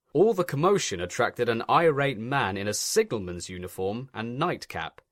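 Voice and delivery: usual speaking voice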